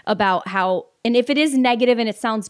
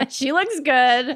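laughing; 4 semitones higher than usual. Real, not acted. Mocking the woman while laughing.

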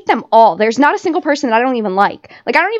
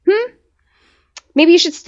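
ordinary delivery

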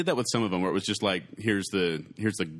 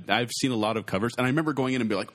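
normal talking voice

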